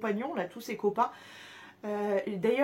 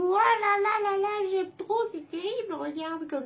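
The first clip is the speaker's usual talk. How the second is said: swooning squeal; 6 semitones higher, 4 dB louder than usual. Real, not acted. Swooning over heart charm.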